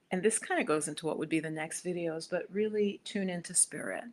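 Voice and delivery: ordinary talk, nothing marked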